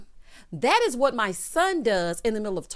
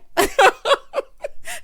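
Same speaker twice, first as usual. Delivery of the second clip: loud high laugh; 8 dB louder than usual. Real, not acted. Laughing at the host's remark.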